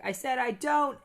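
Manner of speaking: insistent shout. Retold or imitated. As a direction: repeat it with force. Boy repeats rejection.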